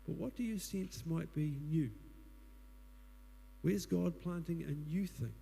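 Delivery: ordinary delivery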